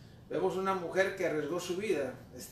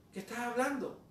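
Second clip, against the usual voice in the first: ordinary talk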